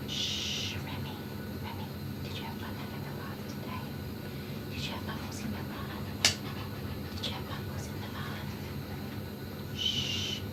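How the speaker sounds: hushed, very soft pet whisper; soothing whisper. Real, not acted. Groomer whispering to the dog about bubbles in the bath, soothing whisper. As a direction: keep him settled with whispers and shushes.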